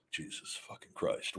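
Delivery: unvoiced, muttered curse under the breath; whispered exasperation. Real, not acted. Host reading spam chats, muttering in a whisper. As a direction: breathe it out in disbelief.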